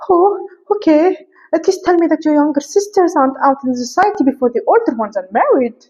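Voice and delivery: high put-on accent; Lady Catherine. Lady Catherine asks about younger sisters, high affected accent. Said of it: "I don't know where I took this accent to".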